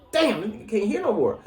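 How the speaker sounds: high-pitched shocked reaction; mock dismayed. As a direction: gasp it out high. Voicing his reaction to eardrum attack.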